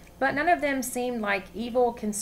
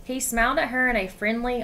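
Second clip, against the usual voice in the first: ordinary delivery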